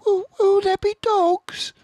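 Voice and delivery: put-on very high, stammering child's fear; scared little fox; terrified cub; frightened cub. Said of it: "he said".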